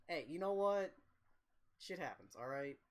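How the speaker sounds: ordinary delivery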